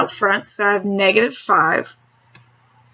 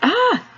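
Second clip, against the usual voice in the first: startled yelp; 12 semitones higher than usual. Real, not acted. Startled by the video placement.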